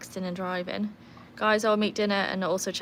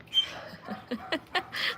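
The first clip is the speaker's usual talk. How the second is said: breathy laugh; 8 semitones higher, 5 dB quieter than usual. Real, not acted. Laughing at dog.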